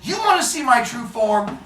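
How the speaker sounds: loud, high mock fury; hammed-up angry god; exasperated shouting. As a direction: lose patience and shout them down. Zeus shouting back in quarrel.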